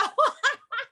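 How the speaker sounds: a short, high burst of laughter. Real, not acted. Laughs after admitting struggle.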